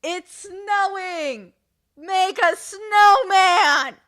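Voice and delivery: very slow, high, loud announcement; excited child actor. Retold or imitated. Actor improvising to stall the show, slow excited call. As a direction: call out wonder to the whole stage. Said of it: "he goes".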